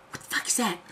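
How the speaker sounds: hushed gasp of fright; gasping whispered shock; startled whisper. Retold or imitated. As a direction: whisper it, scared stiff. Startled whisper at dark thing.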